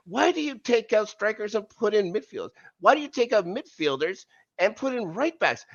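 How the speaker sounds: high-pitched furious rant at the coach; angry rant. Real, not acted. Ranting at the coach Poch.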